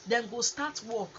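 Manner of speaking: ordinary delivery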